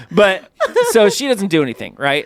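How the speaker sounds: ordinary talk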